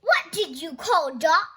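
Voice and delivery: loud indignant demand; angry. Acted. Nelson angrily challenging his best friend, loud. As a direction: puff up with anger. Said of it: "puffing up with anger".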